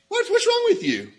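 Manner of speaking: very high, loud, exasperated demand; mock scolding. Preacher shows how we would confront the rich man, scolding. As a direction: act out how people would berate him, pitch leaping up. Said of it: "That's what we would do".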